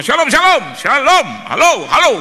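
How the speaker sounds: high, booming phone hello; shouting for signal. Retold or imitated. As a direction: yell greetings into a failing connection. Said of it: "Saya takut signalnya putus".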